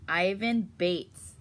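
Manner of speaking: ordinary delivery, nothing marked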